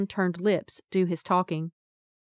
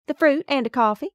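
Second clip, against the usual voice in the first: louder, higher Appalachian mountain twang; 7 semitones higher, 5 dB louder than usual. A narrator's reading. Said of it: "in my mountain twang".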